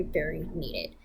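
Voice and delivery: normal talking voice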